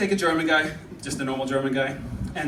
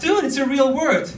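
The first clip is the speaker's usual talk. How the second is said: high, drawn-out protest; exasperated defensive whine; indignant protest; 9 semitones higher, 4 dB louder than usual. Retold or imitated. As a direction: insist to your boss, pitched up and slow. Protesting to his boss.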